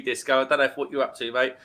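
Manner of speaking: ordinary talk, nothing marked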